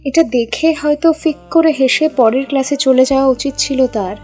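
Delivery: ordinary delivery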